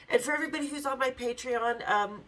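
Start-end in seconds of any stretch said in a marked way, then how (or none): none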